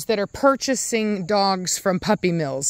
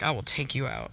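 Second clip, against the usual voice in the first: low, quiet mock threat voicing the dog; menacing dog voice; 8 semitones lower, 10 dB quieter than usual. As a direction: lightly growl it as the jealous dog. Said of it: "She's like".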